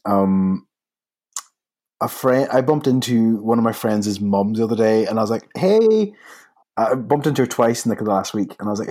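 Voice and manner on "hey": bright greeting. Retold or imitated. Bumping into friend's mum.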